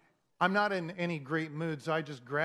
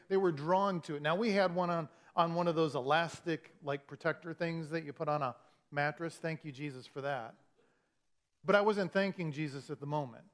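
ordinary talk

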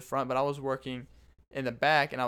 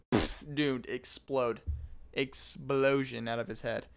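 usual speaking voice

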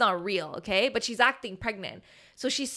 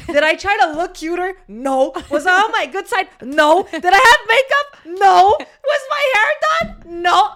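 each question louder and higher; building indignation; 8 semitones higher, 10 dB louder than usual. Acted. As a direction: pile it on, growing outrage at herself. Mock outraged rhetorical questions.

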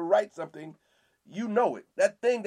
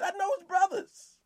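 much higher mocking voice; scornful townsfolk; 13 semitones higher than usual. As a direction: belittle him with a sneer.

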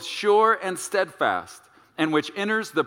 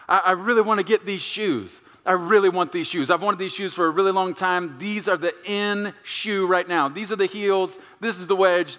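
higher-pitched, insistent begging; pleading wife; 4 semitones higher than usual. Acted. Wife asking husband for these shoes, pleading. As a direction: rattle on like a wife set on new shoes.

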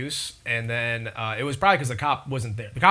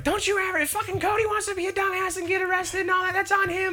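very high falsetto scolding; hammed-up mother's telling-off, pitched way up; mock yelling mom. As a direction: imitate an angry mom in a squeaky-high voice. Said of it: "she's yelling at him".

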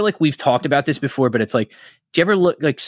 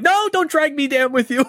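much higher put-on voice, jokey; mock own reply. Joking refusal to the friend on the phone, high mock voice. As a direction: pitch your past self way up in protest. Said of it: "I'm like".

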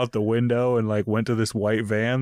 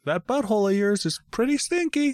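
high squeaky toy duck voice; 11 semitones higher than usual. Acted.

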